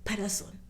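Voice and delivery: softly spoken Patterson, as Pa-ta-son; local pronunciation. Shown as a demonstration. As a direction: quietly model Patterson with open "a" sounds. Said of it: "That's how they say it here".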